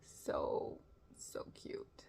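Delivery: soft, whispered cooing; hushed adoration. Real, not acted. Viewer touched by the cloud's ending, hushed adoration. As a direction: whisper it, quite smitten. Moved murmur at the episode's ending.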